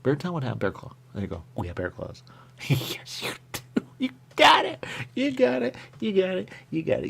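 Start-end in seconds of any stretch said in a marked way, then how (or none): 4.0-7.1 starts very high and loud, then sinks back down step by step; playful squeaky repeat. Pretend. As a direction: squeak it, then let it fade toward normal.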